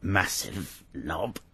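slow, soft, drawn-out mock insult; low mutter. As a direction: say it very softly and slowly. Mock insult under breath.

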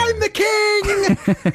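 high, drawn-out crowing cry; long, high-pitched gloating holler; mock triumphant yell. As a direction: crow it out in hammed-up triumph. Mock triumphant boast after reading aloud.

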